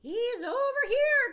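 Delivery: loud, high excited cry; cried out. Acted. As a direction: call out, pointing him out. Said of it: "cried old Beezer".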